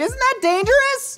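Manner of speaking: very high, drawn-out imitation of alarm; mock alarmed onlooker. Onlookers question balcony turkey frying, mock alarm. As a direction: squeal it as a worried bystander.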